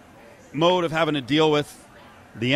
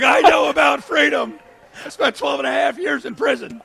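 much higher, loud mock rant; mocking the ranter; 13 semitones higher, 6 dB louder than usual. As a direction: ham up a self-important protester.

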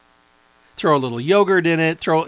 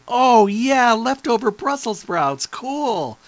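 higher, slower put-on voice of delight; goofy delight; 8 semitones higher, much slower than usual. She mocks herself at the fridge, silly higher delight. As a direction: play up a silly thrill over leftover sprouts. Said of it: "I'm the weird one that's in the refrigerator going".

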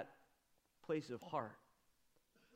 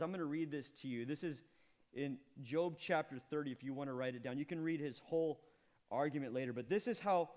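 normal talking voice

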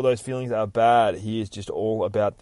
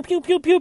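laser pew sounds; 7 dB louder than usual. Retold or imitated. Imitating laser gun fire.

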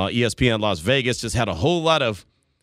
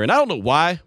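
ordinary talk, nothing marked

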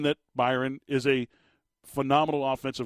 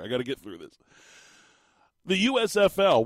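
normal talking voice

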